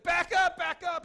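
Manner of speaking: loud, high urgent holler; yelling. Retold or imitated. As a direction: shout it at a driver in a hurry. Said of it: "he's yelling".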